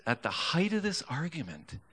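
usual speaking voice

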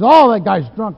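a loud, alarmed retold outburst; upset driver. Taxi driver upset at a van nearly hitting them, loud alarm. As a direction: blurt it out like the startled taxi driver. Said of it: "he got real upset".

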